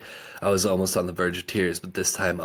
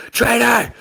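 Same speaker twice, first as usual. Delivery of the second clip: very high, loud cry of traitor; yelling; 12 dB louder than usual. A narrator's reading. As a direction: hurl it at her. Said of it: "yelled out".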